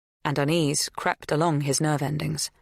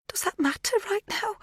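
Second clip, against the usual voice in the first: put-on pleading older woman. A narrator's reading. Magda tearful, pleading.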